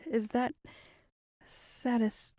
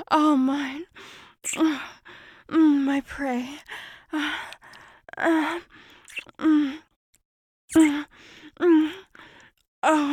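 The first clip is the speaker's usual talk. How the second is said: predatory creature moaning; 4 semitones higher, 8 dB louder than usual. Acted.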